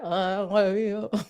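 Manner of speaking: soft mock gargle; gargling. Host asks if it sounds like that, gargling. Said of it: "don't it sound like that".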